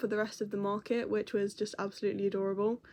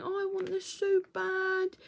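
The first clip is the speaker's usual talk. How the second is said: put-on high, slow childish longing; whiny child; 9 semitones higher than usual. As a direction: whine it like a kid who wants a toy. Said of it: "picking it up and going".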